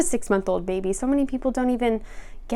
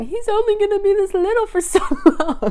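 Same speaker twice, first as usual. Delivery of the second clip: laughing mock lament; 10 semitones higher, 3 dB louder than usual. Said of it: "I wouldn't have said anything other than".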